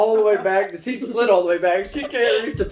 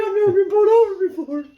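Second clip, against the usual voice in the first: very high, mock-distressed voice; imitated panicky whine; 11 semitones higher, 3 dB louder than usual. Sister to passengers after jumping out, imitated panic. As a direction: wail it in hammed-up panic. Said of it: "He starts crying".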